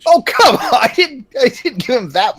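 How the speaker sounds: a high, loud yelp of outrage; indignant surprise. Real, not acted. A player protesting damage just taken, high and loud. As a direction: cry out in mock protest at the hit. Takes twenty-three force damage.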